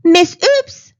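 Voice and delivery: loud, high call of the name; a frantic dentist summoning help; shouting. Acted. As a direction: shout for the assistant across the room. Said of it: "Doctor Dribble shouted".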